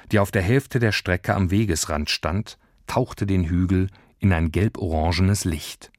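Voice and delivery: plain reading voice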